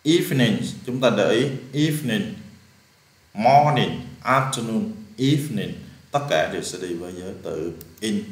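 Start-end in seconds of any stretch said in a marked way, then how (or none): none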